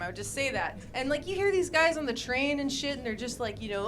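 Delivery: ordinary delivery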